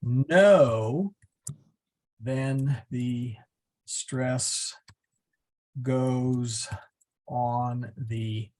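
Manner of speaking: word by word, with long pauses; drawn-out words, spaced for writing down; slow dictation. Real, not acted. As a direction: dictate it slowly as you type. Typing out the rule while speaking.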